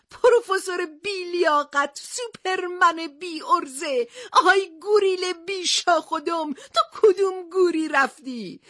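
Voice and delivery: high, slow, breaking cries of abuse; tearful yelling. Acted. Stranded captain cursing absent friends, near tears. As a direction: curse them through tears, dragging the words. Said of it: "از ترس و تنهایی فریاد کشید".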